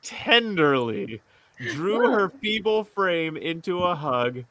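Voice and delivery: soft, slow story reading; story narration. Acted. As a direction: read it tenderly, softly. Reads fantasy story aloud.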